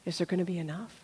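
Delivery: very soft, low private question; hushed worry. Acted. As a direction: let the question drop to near nothing. Acted worried thought, illness ahead.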